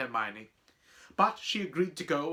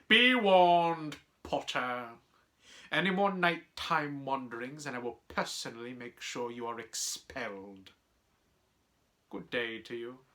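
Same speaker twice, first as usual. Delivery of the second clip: threatening teacher. Acted. Teacher threatening pupil with expulsion, coldly menacing. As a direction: deliver a measured, silky threat. Snape voiced in story.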